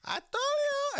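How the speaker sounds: loud, very high Polish mother impression; Polish accent. Officer imitating the driver's mother, shrieky Polish accent. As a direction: squawk it in a mock Polish accent. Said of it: "it was Polish accent".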